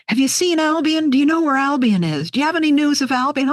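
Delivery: a hint of anxious friends asking after Albion; anxious letter writers. Friends in old letters asking for Albion, lightly voiced worry.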